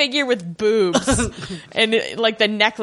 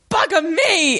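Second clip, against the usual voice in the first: lightly mock British lookout, pitched up; a hint of a startled British sailor; British exclamation; 8 semitones higher than usual. Lookout spotting the iceberg, mock British alarm. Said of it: "Big Book of British Slang".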